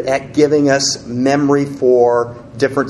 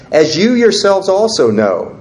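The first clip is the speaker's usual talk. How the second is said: as you yourselves also know read higher and louder, proclaiming; scripture reading; 7 semitones higher, 3 dB louder than usual. Acted. Pastor reading the verse to the congregation, raised proclaiming voice. Reading voice, then repeated plain.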